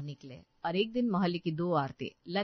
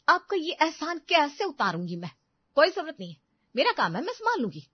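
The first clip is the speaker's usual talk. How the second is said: high, huffy retort; offended daughter-in-law shutting down the offer; sarcastic refusal; 6 semitones higher, 3 dB louder than usual. Acted. Sarcastic refusal during the same quarrel.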